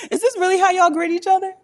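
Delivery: very high squeal through laughter; helplessly tickled, loud and high-pitched; incredulous, laughing. Real, not acted. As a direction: squeak it out fast, nearly crying with laughter. Helpless laughter at street greeting story.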